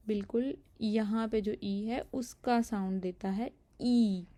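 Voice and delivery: ordinary talk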